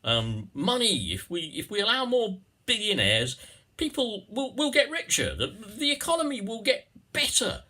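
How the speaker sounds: mocking Conservative politician. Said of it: "when the Conservatives go on about".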